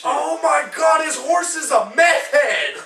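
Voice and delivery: high, drawn-out shout breaking into laughter; amazed shout. Retold or imitated. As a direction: yell the scandalous discovery in hilarity. Acted shocked reaction, shouted, laughing.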